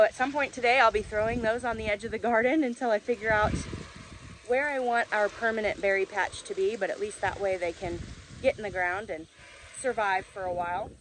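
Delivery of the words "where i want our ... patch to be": usual speaking voice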